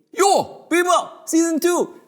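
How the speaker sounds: excited street greeting; hype yo. Acted. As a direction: pump up the crowd.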